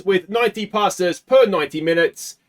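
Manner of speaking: ordinary delivery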